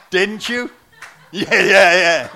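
loud laughing teasing; laughing loudly. Real, not acted. Preacher teasing Kevin in the band, loud laughing.

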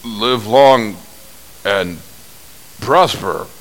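lightly voiced Nimoy, slow and measured; a hint of the Spock greeting; slow, lightly acted Vulcan blessing; Nimoy's greeting. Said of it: "he went".

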